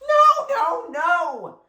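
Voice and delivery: high, loud desperate shout. A narrator's reading. Marshall shouting no to save his blanket, high and loud. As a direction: scream it in protest. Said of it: "With every shout".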